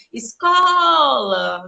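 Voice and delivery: escola drawn out very slowly on CO; calling out the word. Shown as a demonstration. Teacher showing pupils how to call a word, escola with long CO. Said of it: "Gente, chama a palavra".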